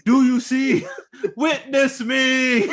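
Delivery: high, drawn-out playful yell; mock triumphant cry. Co-host riffing on the theater outburst, mock triumphant cry. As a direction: cry it out high, stretching the words.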